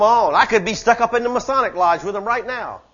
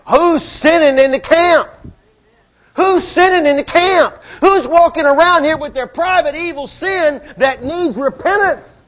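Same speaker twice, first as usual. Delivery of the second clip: loud, high, driving preacher cry; preaching shout; 7 semitones higher, 5 dB louder than usual. Real, not acted. Preacher crying out to church about hidden sin, loud and high. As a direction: thunder the question at the congregation.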